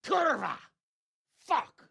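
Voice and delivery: high, loud swearing explosion; furious outburst. Acted. Lord cursing aloud on hearing of worthless coin. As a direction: blow up and curse at full voice.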